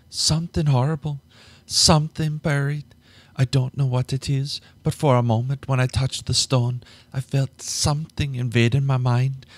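breathless dread of what lies below; high frightened voice; frightened woman. Acted. Terrified woman describes cave.

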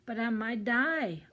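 mock-tearful complaint; wailing. A narrator's reading. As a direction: lament with rising distress. Jake wailing on the ledge.

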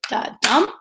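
"da-dum" with a soft da before a rising dum. Shown as a demonstration. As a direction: lean off "da" and land on "dum". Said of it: "the softer beat comes first".

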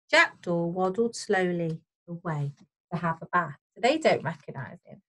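ordinary delivery, nothing marked

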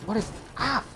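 real high-pitched alarm; flustered panic. Real, not acted. Flustered while attacked in game.

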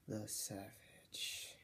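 slow hushed title drop; dramatic whisper. Acted. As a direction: drag it out in a spooky whisper.

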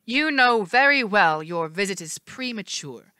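cold regal threat. Acted. Hera threatening coldly.

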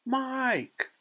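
very high, falling wail of a name; mother's cry. A narrator's reading. Mother calls to her tiny son, crying out. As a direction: cry out for your child. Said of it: "cried Missus Teavee".

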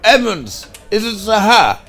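calling out. Acted. Calling out to Edmonds.

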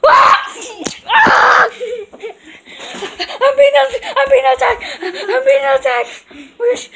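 high, loud pretend panic; panicked yelling. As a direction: scream like you're under attack. Game character attacked, panicked yelling.

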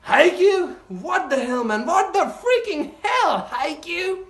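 very high, squealed disbelief; incredulous outburst. Real, not acted. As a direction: exclaim in stunned, delighted disbelief. Incredulous at how good it is.

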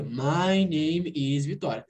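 slow, low, word-by-word "My name is Vitória"; classroom answer. Shown as a demonstration. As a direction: recite "My name is Vitória" like a beginner's drill. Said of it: "Aí você diria".

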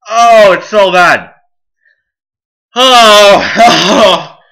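loud, high, drawn-out moan; agonised wail. Real, not acted. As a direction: wail slowly in burning pain. Mouth still burning badly.

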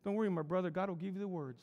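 serene, unruffled comfort after the outburst; calm reassurance. Acted. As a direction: hand back the advice serenely. Young preacher returns the calm advice.

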